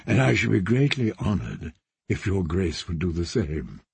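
put-on friendly young lord, deep and relaxed; genial knight. Dre voiced in the story.

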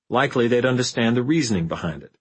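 ordinary delivery, nothing marked

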